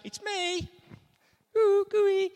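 high, loud gushing mock sweetness; mock a syrupy weak Jesus; soppy gooey voice. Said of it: "Doesn't just say it like that".

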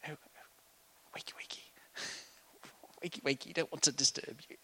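soft whispered wakey wakey with a laugh; gentle whisper. Retold or imitated. Mocking a timid disciple waking Jesus, whispered. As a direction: coax him awake in a tiny whisper. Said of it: "it wasn't the sort of".

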